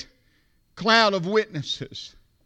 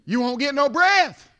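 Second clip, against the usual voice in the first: usual speaking voice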